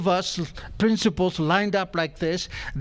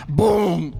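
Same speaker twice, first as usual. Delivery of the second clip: louder mock gunshot boom; a sudden spoken bang; 3 dB louder than usual. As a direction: punch out the bang. Gun fires again.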